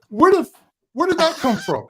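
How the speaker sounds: retold reaction, voice shooting up in bafflement; hammed-up bewildered protest; baffled disbelief. Retelling own baffled reaction.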